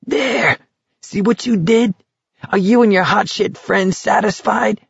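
high-pitched screechy taunt; shrewish screaming. A narrator's reading. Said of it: "Barkovich screamed shrewishly".

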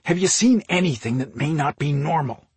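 mildly irritated retort; snapped. A narrator's reading. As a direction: push back with a touch of exasperation. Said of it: "he snapped, in a low voice".

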